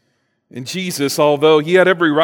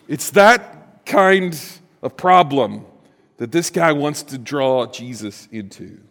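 ordinary talk

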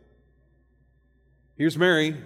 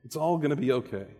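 reassuring Jesus; 3 semitones lower, 5 dB quieter than usual. Retold or imitated. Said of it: "Jesus says".

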